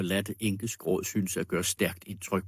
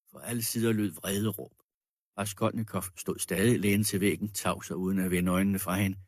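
ordinary delivery

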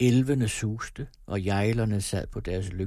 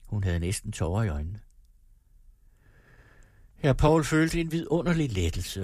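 ordinary delivery, nothing marked

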